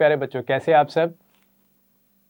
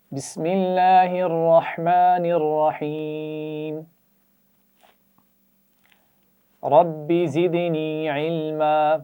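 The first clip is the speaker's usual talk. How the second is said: slow, drawn-out recitation for the class to repeat; recited prayer; 3 semitones higher, 5 dB louder, much slower than usual. Shown as a demonstration. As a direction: chant each phrase slowly, leaving room for the echo. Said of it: "میرے بات پڑھیے".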